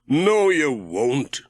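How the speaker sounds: slow, mildly reassuring; calm Pat's fear, slowly; Ted reassuring. A narrator's reading. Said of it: "said Ted".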